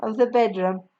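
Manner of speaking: ordinary delivery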